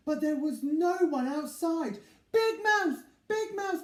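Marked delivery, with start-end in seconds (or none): 2.3-3.8 high, loud call across the room. Acted. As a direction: call out to him in the dark. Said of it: "called Little Mouse".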